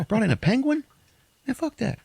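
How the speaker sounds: high squeaky mock disbelief; high mock outrage. Dad jealous of a penguin, high mock outrage.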